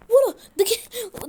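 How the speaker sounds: high, slow, crying wail of fright; panicked wail. Real, not acted. Gamer seeing the skeleton come at him, wailing in fright. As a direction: whimper in terror as it comes.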